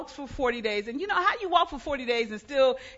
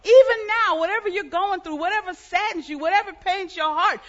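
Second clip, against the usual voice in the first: rising, louder sermon delivery; preaching; 6 semitones higher, 4 dB louder than usual. Acted. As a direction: build the congregation up with fervour. Preaching builds to full force.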